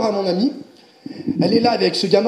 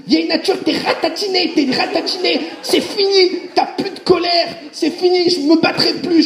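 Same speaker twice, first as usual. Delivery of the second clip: put-on higher, jubilant little boy declaring victory; triumphant child; 9 semitones higher than usual. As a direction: stomp it out like a proud kid. Boy stomping on old nature.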